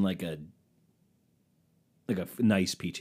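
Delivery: ordinary delivery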